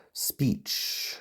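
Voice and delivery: whispering to self; breathy murmur to oneself; whispered. Real, not acted. Muttering puzzle words.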